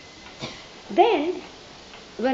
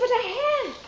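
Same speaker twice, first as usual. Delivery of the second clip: put-on high triumphant child; 13 semitones higher than usual. Child insists she has the rope.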